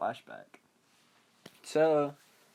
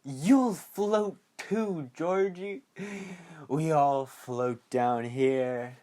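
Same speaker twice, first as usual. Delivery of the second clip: iconic Pennywise line; 2 semitones higher, much slower than usual. Retold or imitated. Pennywise's iconic threat to Georgie, drawn-out creepy clown. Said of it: "you get the iconic".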